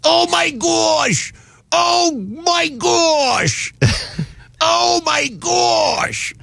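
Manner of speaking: high, slow, shrieking imitation of a gushing woman; hammed-up high gushing woman, drawn out; mock Boston woman. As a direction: squeal like a smitten fan. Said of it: "You just hear it now".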